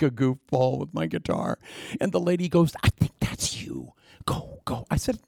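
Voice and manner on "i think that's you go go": urgent backstage whisper. Retold or imitated. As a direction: hiss it so the audience can't hear. Lady whispering backstage cue.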